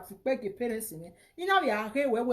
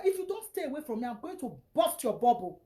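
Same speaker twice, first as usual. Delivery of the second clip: normal talking voice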